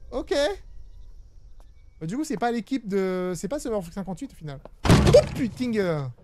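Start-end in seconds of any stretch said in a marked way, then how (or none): 4.9-6.1 startled loud curse; panicking gamer. Real, not acted. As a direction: blurt it out in fright. Jump scare in horror game. (juron paniqué et fort)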